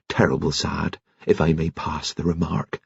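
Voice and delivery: a hint of hushed mourning, kept soft; lightly voiced postman, quietly grave; special solemn voice. A narrator's reading. Postman offering sympathy to Alleyne, softly solemn voice. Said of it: "he said in a special voice".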